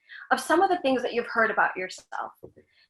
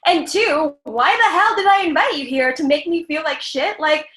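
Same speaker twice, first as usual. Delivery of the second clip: louder and higher, laughing indignant rant; raised, exasperated get-out-of-my-house; indignant kick-out; 4 semitones higher, 6 dB louder than usual. Pretend. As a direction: throw the rude stranger out of your house. Throwing out a rude guest.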